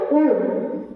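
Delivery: ordinary talk, nothing marked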